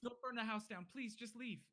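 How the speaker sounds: high-pitched, anxious begging; frightened pleading. Acted. Villagers begging a fire-wielding stranger to go, pleading. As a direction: beg in a high, scared villager voice. Villagers beg the intruder to leave.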